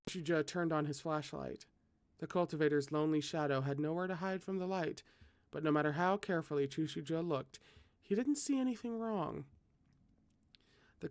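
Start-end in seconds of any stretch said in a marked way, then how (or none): none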